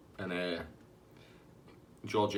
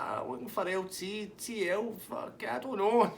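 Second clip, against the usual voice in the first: high-pitched muddled caller; Tasty Dave; 11 semitones higher, slower than usual. Retold or imitated. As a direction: fumble the name in a high mock voice. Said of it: "And he's like".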